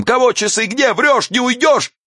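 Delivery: high, terrible wail; very high-pitched woman's yowl; howling woman. A narrator's reading. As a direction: howl it in outrage and pain. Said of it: "она взвыла страшным голосом".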